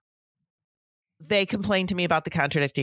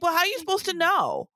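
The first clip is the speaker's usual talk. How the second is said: much higher, incredulous "how are you supposed to know"; exasperated retort; 10 semitones higher than usual. Retold or imitated. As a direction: throw the question up high in disbelief.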